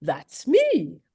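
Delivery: put-on a light British accent for the inner voice; short, crisp British "that's me". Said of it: "this British accented voice go".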